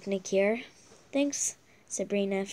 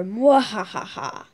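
slow drawn-out villain laugh; evil cackle; 2 semitones lower, 3 dB louder, slower than usual. Acted. Villain laughs after evil plan.

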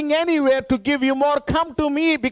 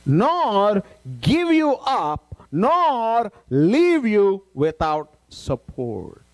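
slow; 2 semitones lower, much slower than usual. Acted. Preacher reads promise very slowly.

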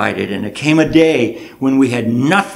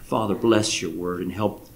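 soft, low closing prayer; quiet prayer; 4 semitones lower, 9 dB quieter, slower than usual. Acted. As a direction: lead the church gently into prayer. Preacher begins closing prayer.

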